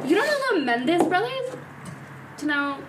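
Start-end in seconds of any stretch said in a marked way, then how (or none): 0.1-1.6 surprised. Real, not acted. Surprised nobody knows them.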